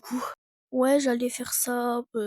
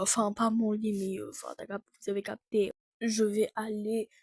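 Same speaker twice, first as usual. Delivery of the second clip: ordinary talk, nothing marked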